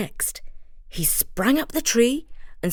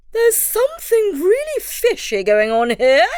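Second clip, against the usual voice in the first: much higher, louder bird voice; high heron voice; 11 semitones higher, 4 dB louder than usual. A narrator's reading. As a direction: chirp it like a suspicious heron. Heron speaks.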